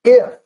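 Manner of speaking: "if" leaping very high, standing alone; stress on if. Shown as a demonstration. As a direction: punch "if" high and let it hang. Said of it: "look at that first word there".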